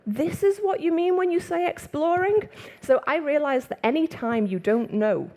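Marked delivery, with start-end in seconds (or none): 0.0-2.5 mock surprise. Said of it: "And he looked at me and went".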